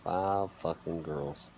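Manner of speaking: ordinary talk, nothing marked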